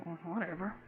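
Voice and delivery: very soft mock dismissal; hushed retold brush-off. Hungry extra dismissing the lady's scolding, hushed. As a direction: brush it off under your breath. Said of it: "the scene was so low".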